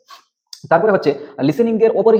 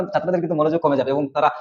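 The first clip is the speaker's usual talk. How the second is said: ordinary talk, nothing marked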